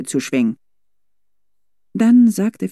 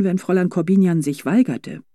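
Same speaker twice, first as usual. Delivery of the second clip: ordinary delivery, nothing marked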